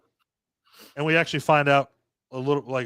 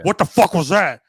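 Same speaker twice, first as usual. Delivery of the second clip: barked question; 3 semitones higher, 8 dB louder than usual. Retold or imitated. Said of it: "So he's like".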